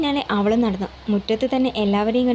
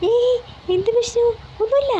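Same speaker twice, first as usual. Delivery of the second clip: high, airy brush-off; breezy dismissal; 12 semitones higher than usual. A narrator's reading. Son reassuring the guest nothing is wrong, breezily. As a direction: wave the worry away.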